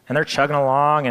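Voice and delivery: ordinary talk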